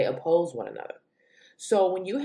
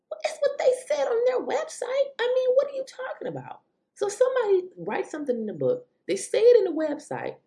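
exasperated. Real, not acted. Exasperated rant at liberals.

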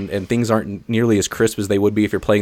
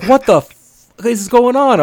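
high, louder retold disbelief; incredulous outburst; 12 semitones higher, 4 dB louder, slower than usual. Driver telling friends of the wheelchair in the street, incredulous. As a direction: blurt it out in mock shock.

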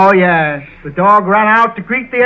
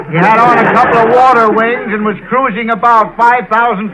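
louder and higher, building to a punchline; mock exasperated answer; 5 semitones higher, 5 dB louder than usual. Comedian answering a silly interviewer, hammed-up and loud. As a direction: lean into the absurd answer with mounting force. Absurd deadpan answer, rising loudness.

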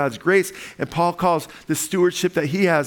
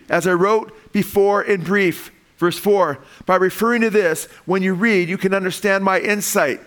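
higher, louder preaching, stretching out unknowable; fervent preaching; 6 semitones higher, 3 dB louder than usual. Acted. Pastor urging the church that God reveals the mystery, fervent preaching. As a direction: drive home that the mystery is beyond reason.

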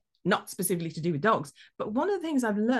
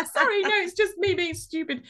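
high-pitched, embarrassed apology; flustered apology; 10 semitones higher, 3 dB louder than usual. Retold or imitated. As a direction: apologize over your own laughter.

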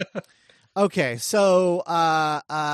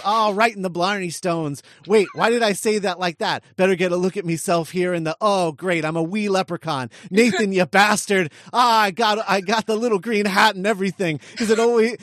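higher-pitched, put-on leprechaun read; 4 semitones higher, 5 dB louder than usual. Reading Billy's scene to co-hosts as a leprechaun, leprechaun voice. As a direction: play an exasperated wee leprechaun. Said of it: "then in a leprechaun voice".

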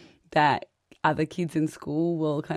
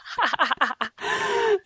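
high giggle; 12 semitones higher than usual. Real, not acted. Laughing at husband's reply.

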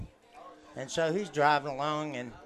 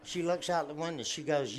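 ordinary talk, nothing marked

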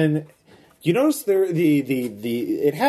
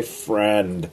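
flat lightly voiced robot voice; 6 semitones lower than usual. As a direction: say it like a little robot. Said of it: "a robot voice say".

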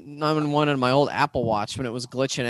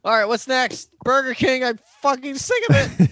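fed-up rant; 9 semitones higher than usual. Pretend. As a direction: vent it in mock frustration. Fed-up rant about Burger King.

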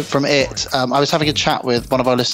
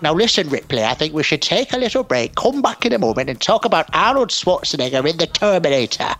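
Alien Three guy voice; 4 semitones higher than usual. Pretend. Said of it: "speaking like the guy from, uh, Alien Three".

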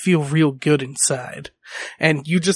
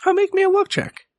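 squeaky high voice; faster than usual. Acted. GM squeaks an excited request.

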